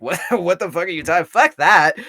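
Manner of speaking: high, fast, indignant outburst; mock-outraged, building to a loud burst; Mimesis. Podcaster voicing one narrator reacting to the other, mock outrage. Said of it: "And then Mimesis is like".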